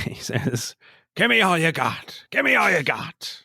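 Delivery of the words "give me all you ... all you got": high, shouted movie-line impression; yelling the famous line with pitch raised; shouted quote. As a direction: belt it out, pitched way up. Quoting Pacino's shouted line.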